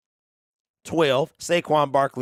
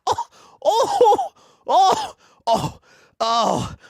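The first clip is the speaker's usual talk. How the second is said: high, loud oh's broken by real laughter; helpless laughter; 13 semitones higher, 3 dB louder, much slower than usual. Real, not acted. Host can't stop laughing about Nick Gates, high laughing oh's. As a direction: crack up completely, gasping out each oh. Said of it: "I'm crying, laughing".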